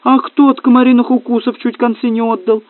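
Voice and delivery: high, indignant challenge; outraged retort; nearly shouting. A narrator's reading. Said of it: "чуть было не закричал Сева".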